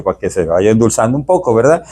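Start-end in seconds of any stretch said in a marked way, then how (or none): none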